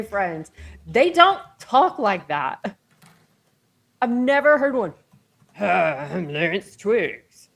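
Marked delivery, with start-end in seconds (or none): 5.6-7.5 깊고 낮게, 아주 느리게, 감정 없이 로봇처럼; mock a flat robot-like man introducing himself; deep monotone voice. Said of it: "That was the deepest, unemotional voice".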